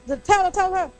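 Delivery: usual speaking voice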